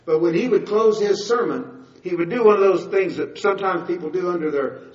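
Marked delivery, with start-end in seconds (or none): none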